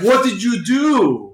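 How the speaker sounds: mock stunned outcry; retold shock. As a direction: drag it out, stunned. Villains shocked at the killing.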